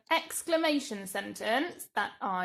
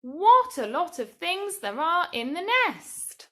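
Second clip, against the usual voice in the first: higher, louder exclamation; exclaimed; 8 semitones higher, 8 dB louder than usual. Shown as a demonstration. Teacher reading a sample answer to children, exclaiming. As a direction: say it with wonder. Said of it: "Maybe you might have written".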